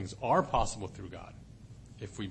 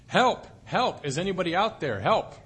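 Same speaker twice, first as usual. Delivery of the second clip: loud, high desperate calls for help; yelling for help; 5 semitones higher, 7 dB louder than usual. Acted. Man hanging off a cliff yelling for rescue, loud and high. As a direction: shout it up the cliff, frantic. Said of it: "So he began yelling for help".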